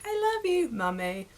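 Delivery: high, loud opening then dropping British voice; badly dubbed British child. British dub over the little girl, high mock child voice. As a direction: do an obvious British dub of a toddler. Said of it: "obvious that you dubbed it over".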